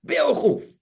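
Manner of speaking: high, sharp cry of idiot; husband snapping at his wife over the burnt sari; scolding. Retold or imitated. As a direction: hurl the insult at her. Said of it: "ये डांट रहा है".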